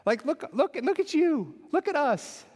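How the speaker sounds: high, bright teasing voice; playful friendly Jesus. Retold or imitated. Jesus joking with Peter after the rescue, high playful teasing. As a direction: rib your buddy, laughing at the moment. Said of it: "Jesus might have even been like".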